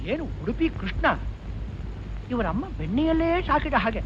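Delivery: soft grumble to oneself; muttered aside. Acted. Owner complaining to Krishna about butter-eater, soft aside. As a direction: mutter it so the customer won't hear.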